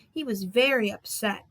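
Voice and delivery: plain reading voice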